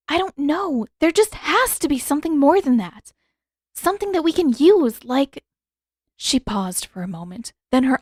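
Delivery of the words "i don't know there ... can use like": put-on higher young woman's voice, pleading and searching; young princess voice pressing for more; Yue, urgent. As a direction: reach up high, anxious to find a way.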